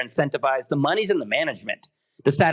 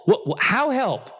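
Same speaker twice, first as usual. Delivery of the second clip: ordinary talk, nothing marked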